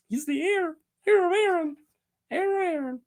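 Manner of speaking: a playful high singsong on "heir". Pretend. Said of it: "The good old hair of Aaron".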